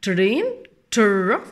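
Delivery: ترين then تر, the TR rolled together loudly; same TR sound. Shown as a demonstration. Teacher compares truck with train, stressed TR. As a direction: hit the shared "tr" of ترين and تر. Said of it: "ممكن اعملها زي ترين بالظبط".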